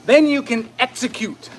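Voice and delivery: normal talking voice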